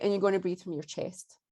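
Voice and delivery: ordinary talk, nothing marked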